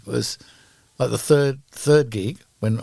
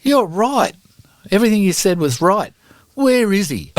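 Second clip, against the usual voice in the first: high, loud, determined; fired up; 10 semitones higher, 4 dB louder than usual. Retold or imitated. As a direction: rise up fired and ready. Chrissy turns furious.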